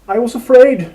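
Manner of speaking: high, loud pained cry. A narrator's reading. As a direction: cry it out through tears of pain. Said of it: "she cried".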